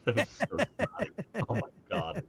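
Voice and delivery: high-pitched, laughing through the words. Real, not acted. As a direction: crack up at your own snotty joke. Cracks up at own snotty joke.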